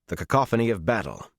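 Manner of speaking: usual speaking voice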